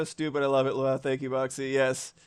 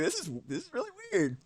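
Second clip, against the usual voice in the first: put-on high, fast, quieter kid voice; high kid bewilderment; young self; 11 semitones higher, 6 dB quieter, faster than usual. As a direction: squeak it out quickly like a puzzled kid. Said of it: "I'm like".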